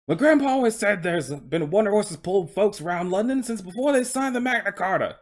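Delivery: loud, high, proud Cockney horse voice; Cockney coach horse. Acted. Horse introducing himself to interviewer, Cockney accent. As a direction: put on a broad, hearty Cockney. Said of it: "he's speaking in a Cockney accent".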